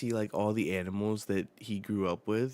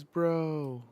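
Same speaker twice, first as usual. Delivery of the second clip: high, louder drawn cry; calling out; 8 semitones higher, 5 dB louder than usual. Acted. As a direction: call out to a friend. Acting out the same call.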